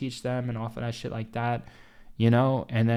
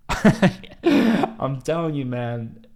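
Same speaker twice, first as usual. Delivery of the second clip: real laugh, much louder and very slow; laughing through words; 2 semitones higher, 8 dB louder, much slower than usual. Real, not acted. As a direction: laugh it out as you say it. Laughs while talking about DM scams.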